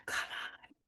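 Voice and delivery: barely voiced plea under the breath; whispered, frustrated aside; exasperated whisper. Real, not acted. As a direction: hiss it softly to yourself at stubborn tech.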